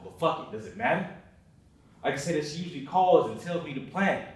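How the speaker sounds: ordinary delivery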